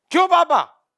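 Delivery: high, loud accusing yell; daughter yelling. Retold or imitated. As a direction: yell it at dad like an angry daughter. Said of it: "खतरनाक तेज से चिल्ला रही है".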